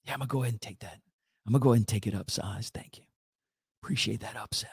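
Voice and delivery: hushed guilty whisper, low. Retold or imitated. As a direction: sneak the order out in a whisper. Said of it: "Here you whisper".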